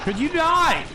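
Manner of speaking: exasperated yelling. Real, not acted. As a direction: yell in disbelief at useless squad. Exasperated at dying AI teammates.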